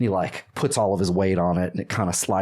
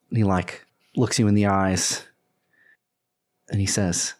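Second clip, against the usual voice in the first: normal talking voice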